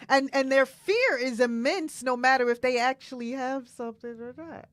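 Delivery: normal talking voice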